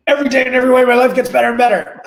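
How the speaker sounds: high, loud, mock-exasperated recital; grudging wife imitation. Wife giving in to husband's nagging, grudging high yell. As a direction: rattle it off loudly as a fed-up wife forced to say it. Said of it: "Now just say it like you mean it".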